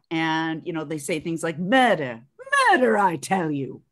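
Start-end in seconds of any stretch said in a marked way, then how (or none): 1.6-3.8 put-on old film-star mid-Atlantic accent; almost British, like an early movie star. Actress imitating thirties film stars, almost-British mid-Atlantic accent. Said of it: "they almost sound British".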